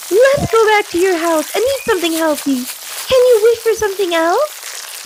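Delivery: a small, wary magical helper; timid genie Rocky. A narrator's reading. Rocky pleads to leave.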